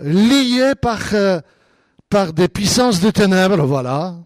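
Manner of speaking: ordinary talk, nothing marked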